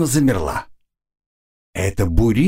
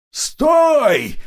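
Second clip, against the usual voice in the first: yell. A narrator's reading. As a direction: yell at the top of her lungs. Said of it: "заорала она во всё горло".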